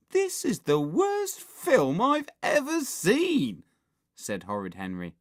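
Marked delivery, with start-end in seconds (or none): 0.1-3.6 slow, high-pitched, loud whining; loud complaint. A narrator's reading. Henry loudly complains about the film.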